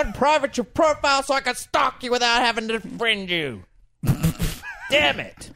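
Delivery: mock frustration. Mock frustration at private profiles.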